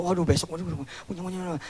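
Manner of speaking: soft, fading worried waduh; quiet anxious sighs; fretful muttering. Retold or imitated.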